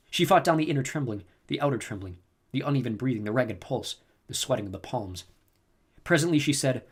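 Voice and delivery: normal talking voice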